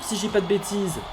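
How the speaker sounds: ordinary talk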